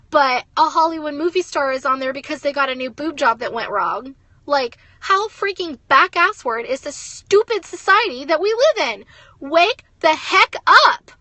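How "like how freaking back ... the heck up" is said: loud, slow furious rant; angry yell. Real, not acted. Mom telling her viewers to wake up, yelling angrily. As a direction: yell it at the whole stupid society. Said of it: "I'm yelling 'cause I'm pissed off".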